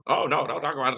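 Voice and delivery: ordinary talk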